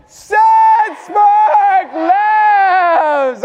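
long booming shout, high and loud, very slow; group shout. Real, not acted. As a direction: bellow "Sunspark Labs" stretched right out. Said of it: "and we shout".

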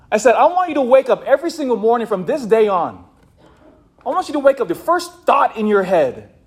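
high, loud preaching at the groom; retold yelling. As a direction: pound every phrase home to the groom. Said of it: "I was yelling at Nick at this point".

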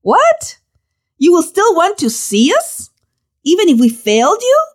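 a disciple amazed that Jesus still wants them; stunned disbelief. Acted. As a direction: react like a disciple who can't believe it.

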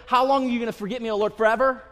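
loud, high, fast protest; indignant David. Retold or imitated. David loudly protests God's silence, indignant. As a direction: hurl the question at God. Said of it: "Like, like".

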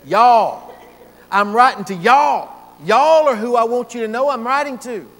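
loud, drawled southern y'all. Retold or imitated. Preacher voicing Paul to the church in a southern drawl. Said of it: "He'd even have a little southern drawl to it".

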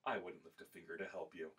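low, soft, icy dismissal; coolly disown a friend, quietly; cold quoted rejection. Retold or imitated.

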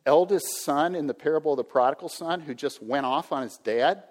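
ordinary talk, nothing marked

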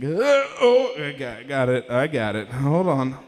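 groaning effort of getting out of a chair; hammed-up elderly struggle; old guy straining. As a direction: strain and grunt like an old man heaving himself up. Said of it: "an old guy gets out of a chair".

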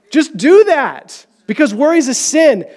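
usual speaking voice

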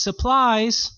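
high, loud pop-out cry; surprise shout. Acted. Korean servant pops out from behind the door, mock surprise shout. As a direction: burst out as if springing a surprise. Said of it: "the Korean man pops out and says".

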